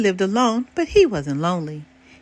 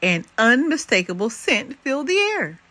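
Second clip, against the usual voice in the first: ordinary delivery, nothing marked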